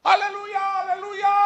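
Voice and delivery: very high, loud imitated shout; teriak haleluya. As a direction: shout it like an overjoyed believer. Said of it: "Dia teriak gitu". (meniru teriakan girang bernada tinggi)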